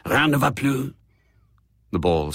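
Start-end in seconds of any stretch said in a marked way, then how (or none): 0.1-0.9 casino croupier. Acted. Croupier closing bets at roulette.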